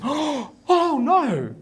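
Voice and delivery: high, mock-scandalised gasp; shocked teacher. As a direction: act appalled at a rude suggestion. Teacher gasps at rude guess.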